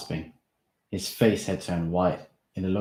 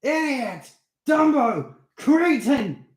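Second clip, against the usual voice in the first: furious yells, much higher and louder; yelling; 9 dB louder, much slower than usual. Acted. Steve yelling insults at Darren, furious. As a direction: hurl each insult like a blow. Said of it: "he yelled".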